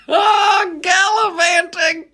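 high laughing exclaim, drawn out; laughing shout. Real, not acted. Host amused by his word, laughing high shout.

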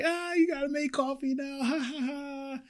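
high teasing coworker voice with a mock laugh. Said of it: "they're like".

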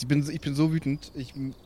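ordinary delivery

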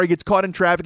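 ordinary talk, nothing marked

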